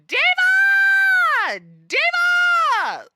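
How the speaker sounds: very high, drawn-out screamed DIVA; piercing, full-volume imitated scream; mock drag queen scream. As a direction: shriek it out of nowhere. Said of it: "I think she just screams".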